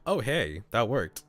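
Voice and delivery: ordinary delivery, nothing marked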